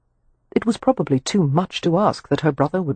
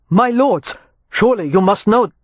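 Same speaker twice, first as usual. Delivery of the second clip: higher-pitched plea to brawlers; raised, insistent gentleman's voice; urgent appeal; 5 semitones higher, 4 dB louder than usual. A narrator's reading. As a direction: appeal to them mid-scuffle, pitched up. Peacemaker appealing while dodging blows.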